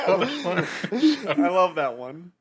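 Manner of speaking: real laughter breaking into high-pitched words; helpless, high giggling speech; laughing hard. Real, not acted. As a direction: talk through a laughing fit. Cracking up over a meme.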